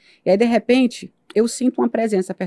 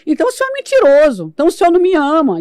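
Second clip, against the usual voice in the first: higher, louder, reproaching God; angry accusation; 8 semitones higher, 4 dB louder than usual. Retold or imitated. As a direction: hurl the accusation upward. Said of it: "E eu comecei a xingar". (acusação furiosa)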